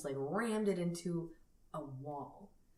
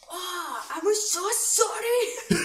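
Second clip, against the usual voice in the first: put-on very high, loud, slow comic accent; botched Scottish accent; 13 semitones higher, 7 dB louder, slower than usual. Retelling the actor's apology on request, botched Scottish accent. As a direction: overdo a failed Scottish apology. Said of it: "say it like he said it".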